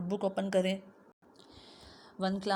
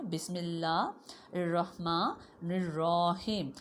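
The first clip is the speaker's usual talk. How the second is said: الرحیم drawn out very slowly; careful teacher recitation; much slower than usual. Shown as a demonstration. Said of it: "اب ہم پڑھتے ہیں تسمیہ".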